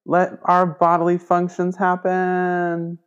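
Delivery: high, loud, slowly stretched out; mock proclamation. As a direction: declare it grandly, dragging out the last word. Acted mock defiant declaration in class.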